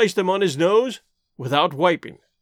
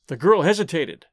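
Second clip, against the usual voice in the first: ordinary delivery, nothing marked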